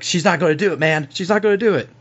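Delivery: mildly exasperated character voice; John, helpless protest. Acted. Game master plays John reporting back.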